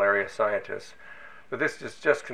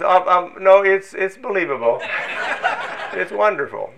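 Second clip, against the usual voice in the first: excited; 10 semitones higher, 8 dB louder than usual. Retold or imitated. Colleague corrects himself, excited.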